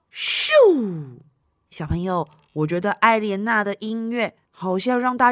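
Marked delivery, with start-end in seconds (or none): 0.1-1.2 quick whooshing zoom; whoosh sound. Acted. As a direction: zip away with a whoosh. Bird zooms off.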